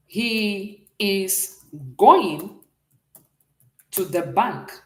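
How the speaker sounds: very slow, drawn-out going; slow teacher model line; slow gloss. Shown as a demonstration. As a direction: read it slowly for the class. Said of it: "I want to say something like this".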